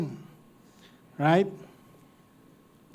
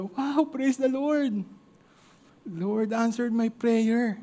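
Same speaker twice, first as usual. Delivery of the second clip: put-on delighted old lady; 6 semitones higher, much slower than usual. Old woman finds the groceries.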